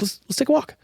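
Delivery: high, bright invitation; upbeat. Retold or imitated. Owner inviting the landlady on a walk, high and upbeat. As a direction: pitch it up, eager. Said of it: "And I was like".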